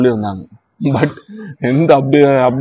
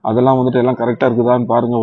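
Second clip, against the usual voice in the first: usual speaking voice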